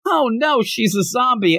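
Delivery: high-pitched dismayed exclamation; mock alarm. Acted alarm at seeing zombie mom.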